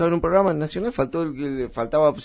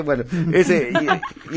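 usual speaking voice

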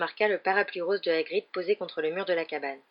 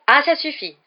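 much higher, louder sharp interruption; cutting in; 8 semitones higher, 10 dB louder than usual. Acted. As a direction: cut him off, embarrassed. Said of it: "coupa Harry".

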